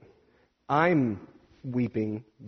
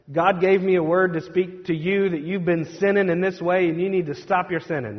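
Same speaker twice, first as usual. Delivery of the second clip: louder, higher mock rebuke; accusing mock prophecy; 5 semitones higher, 6 dB louder than usual. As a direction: call out someone's sin like a self-appointed prophet. Mimics a forbidden accusing prophecy.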